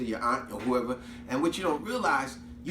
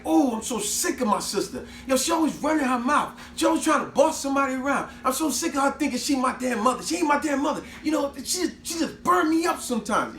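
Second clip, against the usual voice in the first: put-on girl ranting on the phone about her sister; fed-up venting; 10 semitones higher than usual.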